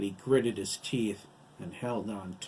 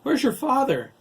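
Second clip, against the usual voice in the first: very high, loud question; frantic high-pitched questioning; terrified demand; 11 dB louder than usual. A narrator's reading. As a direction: shout it in alarm. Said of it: "her expression terrified".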